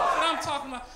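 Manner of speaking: ordinary talk, nothing marked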